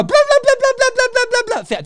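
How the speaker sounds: mocking a phone-distracted moviegoer, dismissive; mocking blah-blah. Real, not acted. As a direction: rattle it off with scorn.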